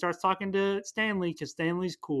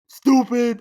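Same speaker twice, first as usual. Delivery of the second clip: scornful bully voice, higher and much louder; mock Tim Blake Nelson; 7 semitones higher, 11 dB louder than usual. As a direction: sneer the insult at the kid. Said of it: "Tim Blake Nelson's like".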